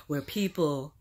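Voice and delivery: breathy aside under the breath; a sudden hushed whisper. Real, not acted. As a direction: let it slip out as a secret whisper. Confides about getting older.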